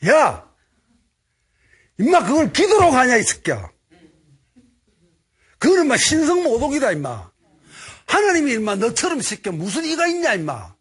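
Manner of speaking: high, loud enraged yelling; furious scolding. Retold or imitated. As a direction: tear into him, full blast. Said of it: "열받아가지고 화가 나는 거예요".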